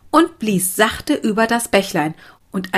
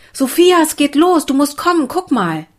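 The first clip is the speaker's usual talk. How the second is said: high, louder call to someone further off; excited calling out; 8 semitones higher, 4 dB louder than usual. A narrator's reading. Children calling Sophia over to the raft, excited calling. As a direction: call out eagerly to fetch someone.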